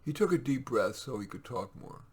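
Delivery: ordinary delivery, nothing marked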